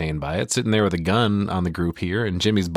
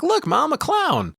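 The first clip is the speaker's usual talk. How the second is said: put-on much higher excited kid voice; little boy; slower than usual. Recapping the movie, the boy spots a clown, high and delighted. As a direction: squeak it out like a thrilled small boy. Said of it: "He's like".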